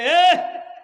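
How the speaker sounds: loud, high call from a distance; calling out from afar. Retold or imitated. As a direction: hail a man far ahead. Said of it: "تو دور سے آواز دیتے ہیں".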